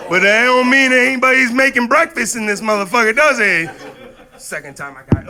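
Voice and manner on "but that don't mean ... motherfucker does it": high, loud sassy comeback; mock defiant yell. Teen talking back to the cop, loud sass. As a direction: shout it like a mouthy teen.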